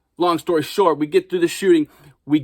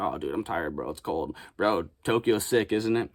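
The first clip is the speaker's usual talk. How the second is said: tired between takes; 6 semitones lower, 6 dB quieter than usual. Retold or imitated.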